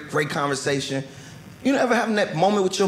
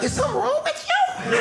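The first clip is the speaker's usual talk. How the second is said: very high, squeaky disbelief; incredulous squeak; faster than usual. Retold or imitated. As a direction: squeak it out, baffled by your wife. Baffled reply to wife's question.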